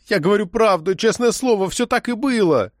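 high-pitched insistent plea; anxious pleading. A narrator's reading. Arrested woman swears honesty to the agent, pleading anxiously.

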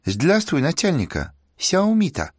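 high, sweet sing-song broken Russian; sing-song Chinese accent. Acted. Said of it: "сладкий певучий голос пропел".

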